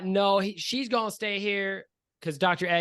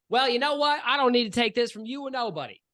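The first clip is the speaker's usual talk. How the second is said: high, raised voice of someone mid-argument; angry shouting; 8 semitones higher, 3 dB louder, faster than usual. Acted. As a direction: protest loudly and high, fed up. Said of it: "where this shouting is coming from".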